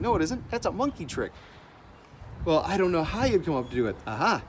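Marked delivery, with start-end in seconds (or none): none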